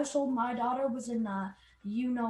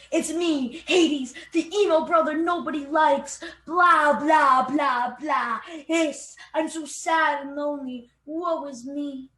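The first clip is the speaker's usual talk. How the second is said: louder, whiny mock lament; mock emo Hades; 3 semitones higher, 6 dB louder, slower than usual. Narrator mocking Hades as a moping emo, loud whine. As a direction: ham up the self-pitying god. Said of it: "He was all like".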